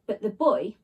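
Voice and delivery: ordinary delivery, nothing marked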